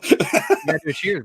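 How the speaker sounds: high-pitched laughing plea. Real, not acted. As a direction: say it through a laugh. Repeats the urge while laughing.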